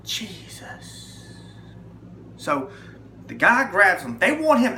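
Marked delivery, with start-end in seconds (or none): 0.0-1.3 breathless frightened whisper; a hushed, scared whisper; whispered Jesus. Retold or imitated. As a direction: barely get the word out in terror. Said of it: "the only thing I could get out of my mouth was".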